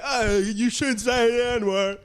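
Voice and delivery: slow, high, prissy scolding voice; mock scolding. Mocking the co-host's gentle scolding, slow and prim. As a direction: drag it out like a prim hall monitor. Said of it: "He's like".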